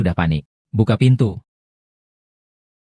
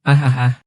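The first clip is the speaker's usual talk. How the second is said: labour groans; 3 semitones higher than usual. Acted. Woman in labour groaning through contractions. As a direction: push through the pain with short groans.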